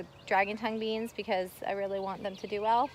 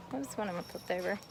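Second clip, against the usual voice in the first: ordinary talk, nothing marked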